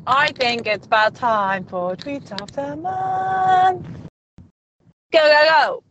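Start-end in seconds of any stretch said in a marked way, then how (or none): 2.0-3.9 slow, drawn-out announcement; segment announcer. Pretend. Host introducing Tweet of the Month, announcer style. 5.1-5.8 high, loud kick-off cheer; excited shout. Pretend. Host kicking off Tweet of the Month, shouting.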